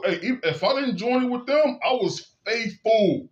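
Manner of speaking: normal talking voice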